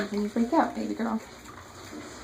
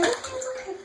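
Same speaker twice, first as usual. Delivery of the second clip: soothing; 14 semitones higher, 5 dB quieter than usual. Real, not acted. Soothing dog in bath.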